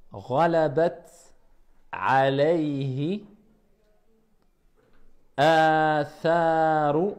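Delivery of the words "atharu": Atharu with the first a held for two beats, slow; long vowel. Shown as a demonstration. Teacher drilling the madd, vowel held two counts. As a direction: stretch the opening a of Atharu. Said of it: "la petite sorte de vague qui indique deux temps".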